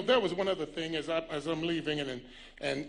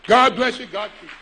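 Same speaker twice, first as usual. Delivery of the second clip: loud, high send-off to the crowd; big preacher's sign-off; shouted blessing; 4 semitones higher, 17 dB louder than usual. Real, not acted. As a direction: belt it out as a closing blessing. Preacher signs off to applause.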